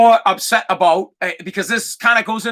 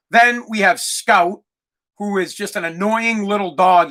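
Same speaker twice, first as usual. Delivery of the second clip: normal talking voice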